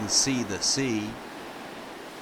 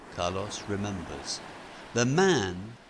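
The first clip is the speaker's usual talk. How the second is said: plain reading voice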